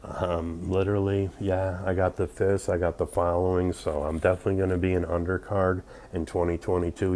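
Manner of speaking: ordinary talk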